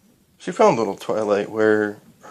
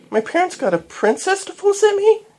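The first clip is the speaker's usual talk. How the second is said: put-on very high, louder little-girl squeal; excited squeal; 5 dB louder than usual. A narrator's reading. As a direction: squeal with thrilled disbelief. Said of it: "she squealed in excitement".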